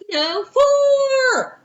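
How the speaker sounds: high, drawn-out call of "fore"; mock golfer's shout. Texas friend demonstrates golf to teacher, mock golfer's shout. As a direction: call it out long and high, as on a course.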